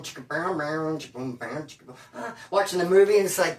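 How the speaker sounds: ordinary talk, nothing marked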